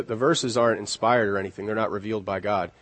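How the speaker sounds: ordinary talk, nothing marked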